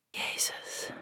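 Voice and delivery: near-silent gasped word; breathless whisper. Acted. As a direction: barely breathe it out in awe. Verbijsterde gedachte van de verteller. (nauwelijks hoorbaar gefluisterd)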